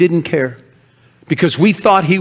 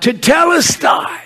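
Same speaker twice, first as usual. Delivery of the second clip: high, slow, ringing shout; cried out; 4 dB louder than usual. Retold or imitated. Jesus on the cross crying out, ringing shout. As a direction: cry the word out to the heavens. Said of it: "He cried out".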